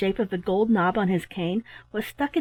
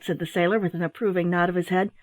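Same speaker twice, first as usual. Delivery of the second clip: ordinary delivery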